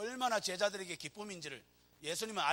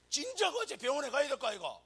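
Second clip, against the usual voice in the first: gruff scolding; 8 semitones higher, 6 dB louder than usual. Retold or imitated. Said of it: "남자들은 이렇게 합니다".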